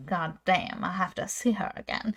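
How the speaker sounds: low, soft imitation of a love-struck man; smitten hero. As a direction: drop low and quiet, playing the smitten guy. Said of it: "And he's like".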